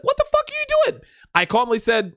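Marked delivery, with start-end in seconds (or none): 0.0-1.0 high mock shriek of an angry girlfriend. Girlfriend yelling at him outside the 7-Eleven, high mock shriek. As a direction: squeal it way up like she's mimicking his girlfriend. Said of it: "she's like".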